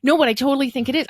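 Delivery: loud, high retold protest; imitating someone emphatically backpedalling; hasty backtracking. Retold man hastily reassures after offending.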